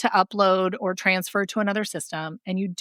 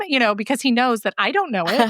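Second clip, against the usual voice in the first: ordinary talk, nothing marked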